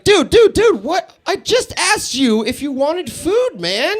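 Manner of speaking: exasperated, high-pitched protest; acting out a fed-up boyfriend. Exasperated man in retold quarrel.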